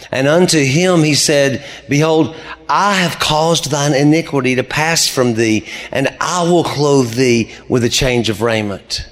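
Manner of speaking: thundering King James reading; preaching at full force. Acted. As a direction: proclaim it from the pulpit. Proclaiming scripture at the climax.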